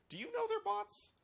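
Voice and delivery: squeaky high mocking question; high sarcastic jab; mock incredulous. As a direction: sneer at them in a high mock voice. Mocking reporter's bot claim.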